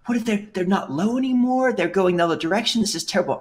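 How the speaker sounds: put-on high, whiny child voice; upset little kid. A young boy upset the planes changed direction, high and whiny. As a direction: fret like a kid whose planes flew away. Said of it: "because I was like".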